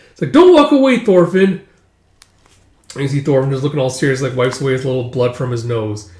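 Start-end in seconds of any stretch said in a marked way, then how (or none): 0.3-1.6 high, loud protest shout; indignant kid's holler; calling after someone. Acted. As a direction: call after him as he leaves. Kids call after Thorfinn.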